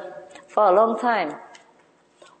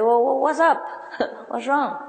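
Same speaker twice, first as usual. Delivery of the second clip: high, startled, laughing "what's up"; the owner taken aback by the angry plumber; surprised owner; 7 semitones higher than usual. Retold or imitated. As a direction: caught off guard and puzzled. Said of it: "the owner was so surprised and said".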